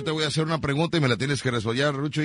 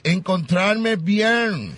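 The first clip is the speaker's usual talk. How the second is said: very slow, drawn out, with a laugh; half-laughing mock English; mock English answer; 5 semitones higher, 3 dB louder, much slower than usual. Shown as a demonstration. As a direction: fake an English reply. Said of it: "tienes que responder en inglés".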